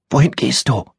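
put-on high child voice, warning; a scared girl telling her to stop talking; timid child's voice; hushed little girl. A narrator's reading. Roommate asks.

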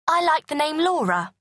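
a touch of a fond mother. Acted. Parents naming baby.

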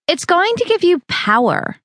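bright pitch-up for the pushy friend; Val's voice. Acted. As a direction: sell her the miracle drink. Val voices her concoction.

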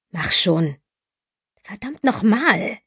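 growled through gritted teeth. A narrator's reading. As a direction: snarl it with jaw locked. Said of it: "knurrte wieder mit zusammengebissenen Zähnen".

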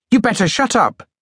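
high, frightened jabbering; scared thin boy jabbers at the soldier; fearful jabber. Acted. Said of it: "the thin boy jabbered".

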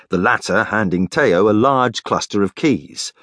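ordinary delivery, nothing marked

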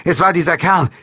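rattled and loud; agitated exchange. A narrator's reading. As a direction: talk frantically over the radio. Frantic radio exchange after a gunshot.

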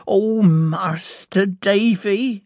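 voix haute et lente, au bord des larmes; sobbing. A narrator's reading. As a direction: plainte lente, la gorge serrée de chagrin. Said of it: "she sobbed".

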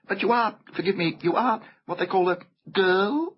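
high, searching fawn voice; hesitant polite fawn. A narrator's reading.

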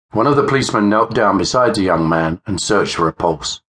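ordinary delivery

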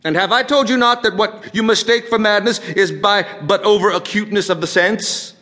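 high, loud and agitated madman; rising outrage with hard-hit key words; mounting fury. Acted. Madman describing the hideous vulture eye, furious and agitated.

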